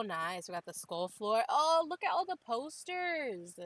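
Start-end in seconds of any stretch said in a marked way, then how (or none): 1.5-3.6 high, loud, drawn-out squeal of delight; thrilled, stretched-out high exclamation; excited delight. Real, not acted. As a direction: gush slowly and loudly over a discovery. Delighted at discovering the posters.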